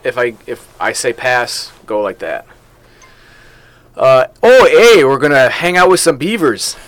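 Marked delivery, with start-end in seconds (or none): 3.9-6.8 louder mock Canadian accent, hammed-up. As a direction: put on a jokey Canadian accent.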